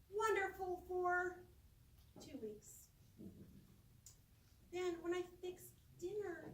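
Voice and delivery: ordinary talk, nothing marked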